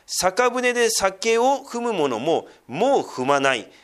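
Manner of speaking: loud, slow, solemn Bible reading; scripture reading. Acted. Pastor reading Jeremiah's lament to the congregation. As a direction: proclaim each line with weight. Reads scripture passage aloud.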